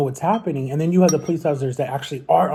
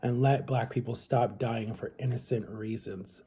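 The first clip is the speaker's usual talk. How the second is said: prayer; 3 semitones lower, 6 dB quieter than usual. Real, not acted. Closing a spoken prayer.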